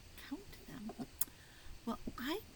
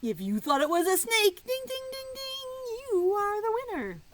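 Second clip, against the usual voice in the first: much louder and higher, ringing ding ding; game show excitement; 12 semitones higher, 8 dB louder than usual. Acted. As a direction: announce the winner like a quiz host. Announcing the winner to children.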